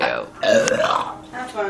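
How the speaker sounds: belched, very low and gurgly; burping the words. Pretend. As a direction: burp it out word by word. Friends burping words for fun.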